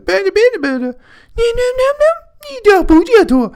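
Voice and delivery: put-on very high, loud cooing baby talk; mock the family's high-pitched baby babble. Mocking the family talking to the baby, in shrill high baby talk. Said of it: "They're just like".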